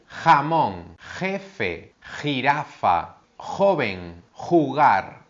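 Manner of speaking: "jamón", "jefe", "jirafa", "joven", "jugar" with a rough throaty j; careful teacher demo of the j sound; strong j demo. Shown as a demonstration. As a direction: rasp the j from the back of the throat. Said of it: "es una G más fuerte".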